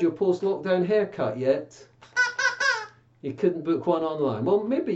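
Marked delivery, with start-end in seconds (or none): 2.2-2.9 very high squeaky puppet cackle; a bird puppet's shrill chuckle; high cartoon bird laugh; puppet squawk laugh.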